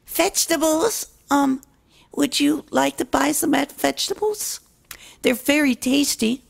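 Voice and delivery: high filly voice, hesitant; high, unsure young filly offering vegetables; shy little filly. Acted. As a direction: ask the customer timidly. Noi's shy first sale.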